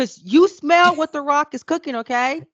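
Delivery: loud, high catchphrase shout; playful, full-voiced boast; Rock catchphrase. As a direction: belt it out like a wrestling catchphrase. Shouts wrestler's catchphrase at friend.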